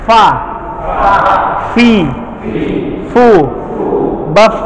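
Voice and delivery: Fa, fi, fu, baf each with air pushed out; breathy letter drill. Shown as a demonstration. Teacher drilling students on breathy Fa fi fu, air audible. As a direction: push breath out on every Fa, fi, fu, baf. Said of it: "ada nafas yang kebuang di depan mulut".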